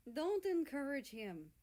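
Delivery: half-annoyed grumble at his brother; grumbling. A narrator's reading. Russia to America, slow grumble. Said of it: "Russia grumbles".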